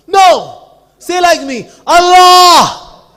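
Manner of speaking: very loud, high raging yell; furious shout. Retold or imitated. Fanatic forcing Maharaj to repeat, furious shout. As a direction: scream the command with full force. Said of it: "Now he was becoming angrier".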